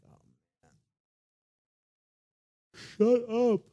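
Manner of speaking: high, loud, fed-up "Shut up"; tired, exasperated. Retold or imitated. Tired Jesus woken from a nap, exasperated outburst. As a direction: sigh "Oh, man", then snap it out. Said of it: "I imagine he's tired".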